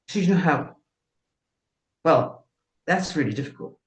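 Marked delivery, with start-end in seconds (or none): none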